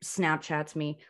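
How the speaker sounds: normal talking voice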